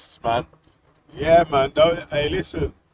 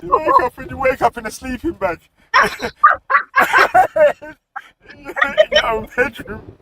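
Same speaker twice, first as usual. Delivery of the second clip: laughing; 12 semitones higher, 6 dB louder than usual. Real, not acted. Cracking up at the sleeping-bag joke.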